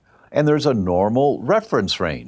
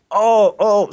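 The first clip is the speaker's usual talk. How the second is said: two high, louder "oh"s of pain; mock pained cries; 10 semitones higher, 5 dB louder than usual. Aunt struck by internal hemorrhaging, acted-out pained cries. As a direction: imitate sudden agony. Acts out aunt's severe pain.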